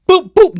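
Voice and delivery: loud, punchy vocal thuds; imitated punches. Storyteller acting out knocking someone out, loud punch noises. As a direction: sound out two hard blows. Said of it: "knocked him out".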